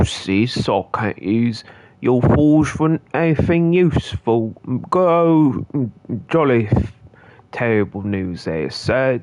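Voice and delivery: hammed-up British accent, lightly done; a streamer's joking British accent with sir and indeed; mock British accent. Streamer goofing in a British accent with game characters. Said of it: "terrible accents".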